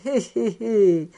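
lightly acted "hé hé" chuckle; chuckling. A narrator's reading. Man laughing to himself while changing, light mock chuckle. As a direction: chuckle to yourself, spoken as much as laughed. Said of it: "il riait et se disait".

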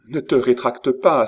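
exclaimed protest; crying out. A narrator's reading. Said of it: "s'écria-t-il".

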